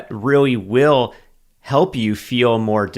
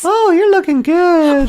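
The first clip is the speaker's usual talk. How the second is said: high laughing falsetto of wife; shrill mock girly voice while laughing; falsetto imitation; 6 dB louder than usual. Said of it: "that's what I sound like to you".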